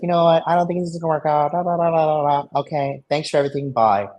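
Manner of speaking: high, fast, huffy imitation of her; mock angry girlfriend. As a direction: rattle off her breakup in a mocking girl voice. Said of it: "She's like".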